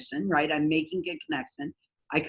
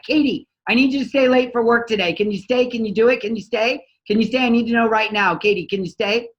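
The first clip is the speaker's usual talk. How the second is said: loud, higher, rapid-fire demands; mock demanding boss; 4 semitones higher, 6 dB louder than usual. Boss barging into employee's office, loud and demanding. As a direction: hammer her with pushy questions. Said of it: "bust into her office and be like".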